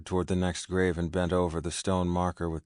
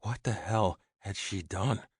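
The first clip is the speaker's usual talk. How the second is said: usual speaking voice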